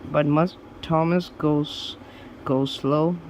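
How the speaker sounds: plain reading voice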